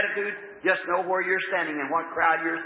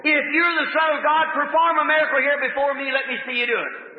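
challenging devil; 7 semitones higher than usual. Acted. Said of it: "the devil said".

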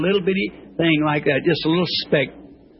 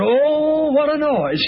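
loud and high exclamation; excited; 10 semitones higher, 4 dB louder, much slower than usual. Real, not acted. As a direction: burst out loud and high in delight. Squirrel making a racket.

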